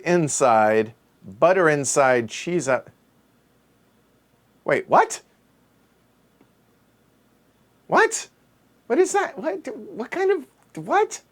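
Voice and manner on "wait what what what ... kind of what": high, incredulous what; baffled. Real, not acted. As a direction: squeak it out in disbelief. Baffled by odd grilled cheese.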